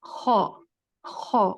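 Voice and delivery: خو rasped from behind the uvula, short and isolated; throaty kho demo. Shown as a demonstration. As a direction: scrape out خو, خو from the back of the throat. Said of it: "کوے کے back والے area سے".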